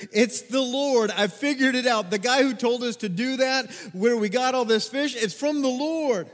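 the disciple cries out, high and loud; excited realization. Acted. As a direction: announce the discovery with sudden excitement. John's excited realization, voiced.

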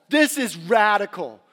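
swelling emphasis on "This is radical". Acted. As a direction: punch "This" in "This is radical" with rising force. Repeated, louder and slower.

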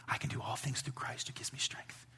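hushed whispered recital of the verse. Real, not acted. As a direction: whisper the verse almost unvoiced. Quoting his life verse softly.

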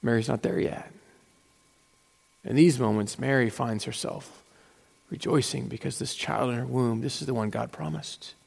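soft, low, grave telling; hushed lament at the cross; solemn hush. Real, not acted. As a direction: drop low and let each phrase land. Describing crucifixion solemnly.

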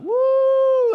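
high, loud, drawn-out "woo"; loud whoop. Real, not acted. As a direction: let out a pulpit whoop. Preacher reacts to humbling verse.

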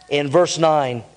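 ordinary delivery

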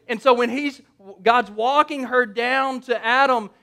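ordinary talk, nothing marked

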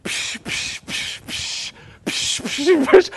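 rapid bursts of spraying "psh" noises; imitated fountain spurts. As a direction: spurt like jets in a fountain show. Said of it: "like a fountain show".

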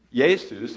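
"Jesus" as slow YAY-soos; Greek pronunciation. Shown as a demonstration. Pastor lists the ancient names, "Jesus" said slowly as Greek YAY-soos. Greek name beside Hebrew name.

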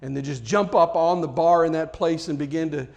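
ordinary talk

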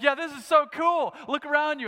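mock-giddy rollercoaster cheer; thrilled at the top. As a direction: gush about the view like a kid on a ride. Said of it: "the gamut of emotions going up there is like".

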